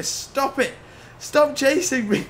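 high, whiny begging; exasperated plea. Real, not acted. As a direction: beg them to stop, fed up. Pleading with chasing police.

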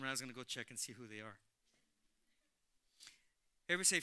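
usual speaking voice

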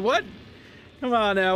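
usual speaking voice

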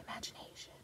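breathless little whisper; awed whisper. Acted. Showing the children the picture, an awed whisper. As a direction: hush the word in wonder.

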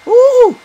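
loud, very high "woo"; piercing falsetto-high whoop. Real, not acted. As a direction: whoop sharply mid-game. Whoop on finding something.

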